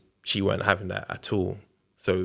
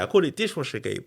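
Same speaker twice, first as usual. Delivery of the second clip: usual speaking voice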